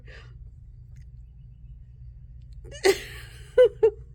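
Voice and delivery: high, breathless laughing fit; real lingering fit of giggles; helpless laughter. Real, not acted. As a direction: keep cracking up despite yourself. Cannot stop laughing, apologizing.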